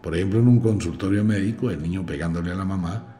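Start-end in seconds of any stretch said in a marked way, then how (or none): none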